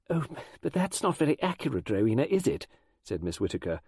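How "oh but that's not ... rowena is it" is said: high, prim correction; fussy schoolteacher picking at accuracy; disapproving. A narrator's reading. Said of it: "resetting her pince-nez on her nose disapprovingly".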